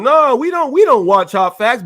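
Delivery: high, loud whiny denial; mocking rivals lying. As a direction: squeal it like a caught liar.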